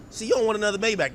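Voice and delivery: normal talking voice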